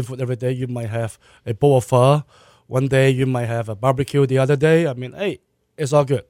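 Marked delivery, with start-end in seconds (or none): none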